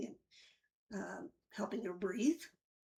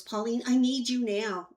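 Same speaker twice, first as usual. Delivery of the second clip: urgent call; 3 semitones higher, 9 dB louder than usual. Retold or imitated. Nurse urgently summons her.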